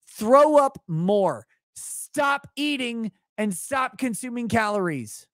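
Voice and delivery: high, stretched-out mock command; mock fat shaming. Streamer ends requested diet rant, high mock exasperation. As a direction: wail the orders slowly in hammed-up exasperation. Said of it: "could I get a drunk fat shaming".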